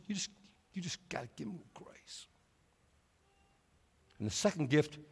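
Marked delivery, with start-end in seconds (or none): 0.1-2.3 hushed, breathy whisper. Real, not acted. Preacher urging the congregation to give grace, hushed whisper. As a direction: drop to a whisper to land it. Tender point in sermon.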